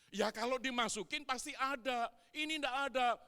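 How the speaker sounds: loud, high sharp comeback; wife arguing back loudly; wife's angry retort. Retold or imitated. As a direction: cut him down, it isn't here.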